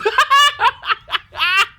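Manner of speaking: very high laugh; neighbors laughing. Acted. The neighbors see the bat, high rolling laugh. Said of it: "began to".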